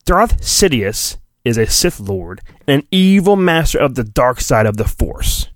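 ordinary delivery

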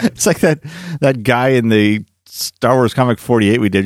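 ordinary delivery

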